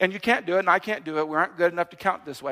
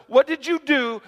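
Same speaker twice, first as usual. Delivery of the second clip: accusing question; 6 semitones higher than usual. Retold or imitated. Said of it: "someone says".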